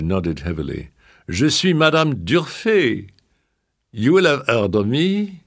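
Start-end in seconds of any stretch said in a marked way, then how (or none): 1.3-5.4 high voiced grand old madame; old French lady. A narrator's reading.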